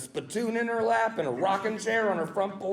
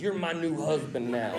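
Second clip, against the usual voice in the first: low, soft seductive murmur; best friend's sultry line; 9 semitones lower, 3 dB quieter than usual. Retold or imitated. Transformed best friend to the listener, low and soft. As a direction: purr it low like a come-on. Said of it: "your best friend in the whole world goes".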